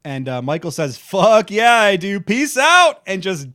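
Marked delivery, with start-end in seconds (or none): 1.0-3.0 loud, high action-hero sign-off; hammed-up triumphant yell, pitch jumping up; exuberant acted shout. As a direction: belt it out with mock bravado. Acting out Michael's gleeful helicopter exit.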